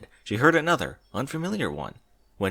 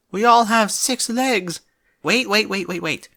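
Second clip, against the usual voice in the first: panicked cry; 11 semitones higher, 5 dB louder than usual. Acted. Panicked outburst.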